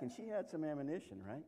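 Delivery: ordinary talk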